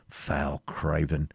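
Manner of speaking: usual speaking voice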